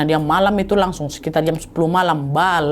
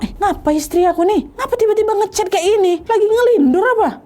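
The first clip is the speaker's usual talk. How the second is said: puzzled; 13 semitones higher than usual. Acted. Husband puzzled by chat.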